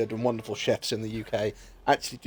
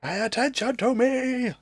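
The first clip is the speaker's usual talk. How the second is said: whiny plea; 11 semitones higher, slower than usual. Acted.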